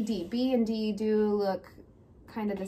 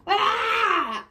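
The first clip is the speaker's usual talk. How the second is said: high, loud mock scream; 9 semitones higher, 6 dB louder than usual. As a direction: act out a child's scream. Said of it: "I scream".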